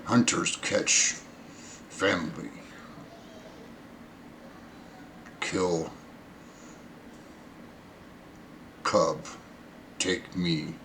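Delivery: low, halting, very soft creature voice; hushed broken words, long pauses between them; captive creature. Acted.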